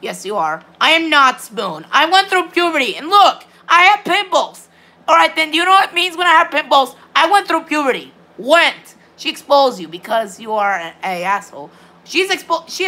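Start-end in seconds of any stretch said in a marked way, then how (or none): 0.8-8.9 high, worked-up protest; real offended self-defence, higher than usual; indignant insistence. Real, not acted. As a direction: insist on it, pitch climbing. Insists he went through puberty.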